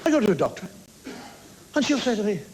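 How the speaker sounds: ordinary talk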